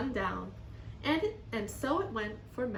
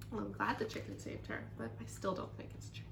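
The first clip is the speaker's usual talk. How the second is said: whispering; 7 dB quieter, faster than usual. Real, not acted. Teacher's doubtful aside.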